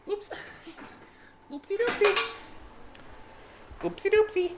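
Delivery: very high, cutesy squeak; tiny high-pitched playful voice; playful oopsie. Pretend. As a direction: squeak it like a cartoon mishap. Clumsy slip while cooking, playing cute.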